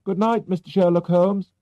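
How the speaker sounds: put-on a young stranger tossing a good night, pitched high; young man's greeting. A narrator's reading. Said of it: "a young man hurried past us and said".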